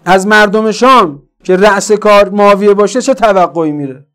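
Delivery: full-force preaching. Real, not acted. Preacher asking what to expect under Muawiya, full-force preaching. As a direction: press the question hard on the listeners.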